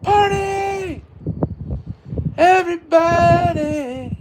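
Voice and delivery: high, excited whoop to kick off the party; party cheer. Real, not acted. Streamer hyping up his viewers, high excited party cheer. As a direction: cheer the chat on like a party host. Hype cheer greeting the chat.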